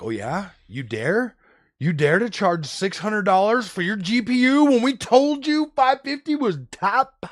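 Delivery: rising into a high, loud indignant mock; mocking reviewers. Reviewers scolding AMD, mock outraged and high. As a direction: sneer like an offended reviewer. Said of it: "They're like".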